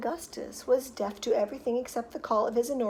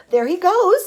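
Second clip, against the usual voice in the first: high, loud call; excited shout; 8 semitones higher, 11 dB louder than usual. A narrator's reading. Onlooker spotting boy in pipe, excited shout. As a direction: shout it to the crowd. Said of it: "somebody shouted".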